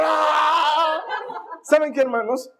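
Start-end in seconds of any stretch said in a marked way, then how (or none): 0.0-1.0 long high "aaah" scream; hammed-up roller-coaster scream, held long; reenacted scream. Preacher reliving his first roller-coaster drop, screaming. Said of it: "empiezo a gritar".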